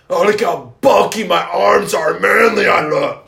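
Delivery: loud, strutting mock boast; hammed-up flexing brag. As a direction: shout it like a proud show-off.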